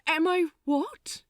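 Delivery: high and loud, baffled and stumbling; total confusion. Acted. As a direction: sputter it, completely lost. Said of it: "he asked in total confusion".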